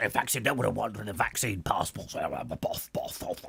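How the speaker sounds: low, quiet blustering mumble; mock Boris bluster. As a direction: mutter it like a flustered prime minister.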